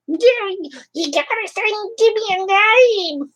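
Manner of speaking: very high, squeaky creature voice, slow and drawn out; a tiny puppet greeting its fans in a high squeak; Crotchy puppet voice. Acted. Puppet Crotchy greets the live chat viewers in a very high squeaky voice.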